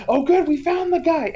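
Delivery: high, quick relieved line; mock delighted discovery; imitating relieved director. Relieved director finds his man.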